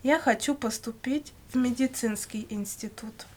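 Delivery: ordinary talk, nothing marked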